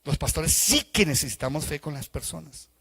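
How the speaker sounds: ordinary delivery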